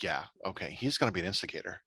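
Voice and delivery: hushed inner voice; quiet knowing. Retold or imitated. Quiet knowing thought about the character.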